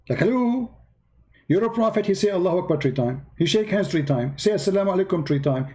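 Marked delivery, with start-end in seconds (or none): 0.1-0.8 mocking hello. Real, not acted. Mocking disbelief.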